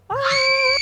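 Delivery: long, loud, high scream; continuous scream. Retold or imitated. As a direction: hold one unbroken re-enacted scream. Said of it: "still screaming, mind you, just a one continuous".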